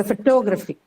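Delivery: "photography" stressed on the second syllable, falling at the end. Shown as a demonstration. Teacher shows students the shifted stress, second-syllable stress on "photography". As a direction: lean on "TOG" in "photography". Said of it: "stress is now changed to the second syllable".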